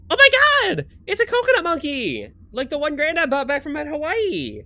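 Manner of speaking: high-pitched gleeful discovery; excited kid character. Acted. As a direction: squeal over a treasured find. Voices excited game character.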